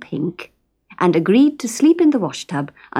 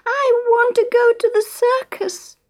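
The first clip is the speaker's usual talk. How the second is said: put-on high wailing little girl voice; wailing mermaid girl. A narrator's reading. Little mermaid wailing at Fred and Isa, very high. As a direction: wail it like a spoilt small child. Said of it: "she wailed".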